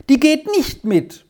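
put-on high-pitched defiant child's cry; crying out in protest. A narrator's reading. Squirrel defies Malva over the grammar, crying out. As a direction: refuse in a high, insistent cry. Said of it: "schrie Squirrel auf".

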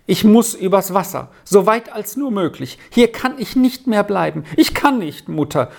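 high, desperate insistence; desperate son pleading his case; insistent. A narrator's reading. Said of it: "versicherte Sami".

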